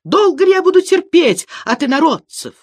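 high, loud indignant outburst; outraged wail. A narrator's reading. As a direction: cry out as if at the end of patience. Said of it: "завопил Саранин". (громкий возмущённый вопль)

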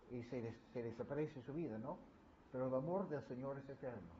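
normal talking voice

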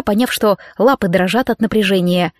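plain reading voice

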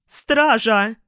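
high, loud shriek of alarm; shrieking countess. A narrator's reading. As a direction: shriek for the guards. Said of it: "завизжала графиня".

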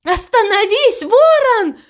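very high, alarmed shout; urgent shout. A narrator's reading. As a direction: shout high to stop someone. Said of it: "закричала мама Му". (громкий крик высоким голосом)

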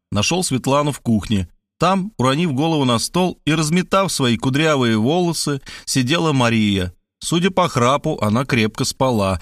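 ordinary delivery

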